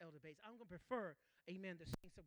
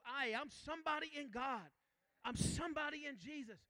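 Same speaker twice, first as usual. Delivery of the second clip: louder, higher exhortation; rising preaching; 5 semitones higher, 9 dB louder than usual. Acted. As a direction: rebuke the flesh, push them up. Preaching rebuke of worthlessness.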